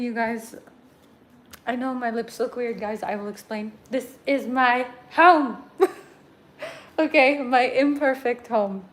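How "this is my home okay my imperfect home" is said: excited. Real, not acted. Proudly showing her messy apartment.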